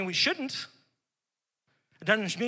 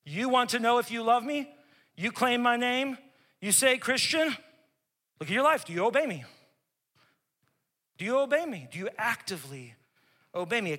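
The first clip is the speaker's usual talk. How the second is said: challenging, pointed questions; searching pulpit challenge; preaching in Christ's voice; 3 semitones higher than usual. Acted. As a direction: press the congregation hard.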